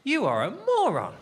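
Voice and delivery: high, emphatic insult to himself; mock self-scolding. Comic berating himself over onion, high mock outrage.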